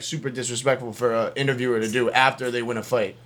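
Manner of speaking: usual speaking voice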